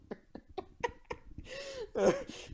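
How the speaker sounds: squeaky high giggle; high laugh. Real, not acted. Reviewer laughing at his own cursing, high giggle. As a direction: let a high laugh slip out.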